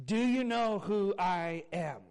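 slow, sincere asking; gentle question. Retold or imitated. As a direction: ask it kindly, not demanding. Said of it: "not asking it in a demeaning way".

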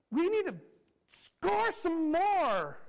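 high, loud, drawn-out mock yell; imitated mother's yell, very stretched; yelling sideline mom. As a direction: holler it across the field like a soccer mom. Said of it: "And she yells that".

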